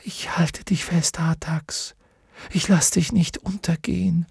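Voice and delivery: soft unvoiced whisper. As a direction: whisper a promise close to his ear. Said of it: "flüsterte er".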